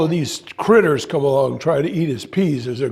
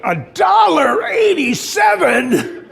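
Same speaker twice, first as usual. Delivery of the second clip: high, slow outraged shout with a laugh in it; 9 semitones higher, slower than usual. Retold or imitated. Shopper shouting at the price of a little can of peas, outraged. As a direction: bellow the price in disbelief. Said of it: "I got very upset".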